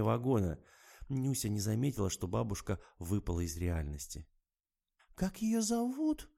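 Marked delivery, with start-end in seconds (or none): 5.2-6.3 put-on much higher, slow anxious little girl; a touch of worry in it; slightly worried. A narrator's reading. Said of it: "с лёгким беспокойством повторила".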